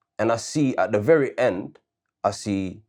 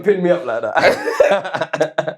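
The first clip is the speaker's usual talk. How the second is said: high, loud, drawn-out protest through laughter; laughing protest; 8 semitones higher, 5 dB louder, much slower than usual. Real, not acted. Debater cornered by co-host's question, laughing protest. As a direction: object loudly while cracking up.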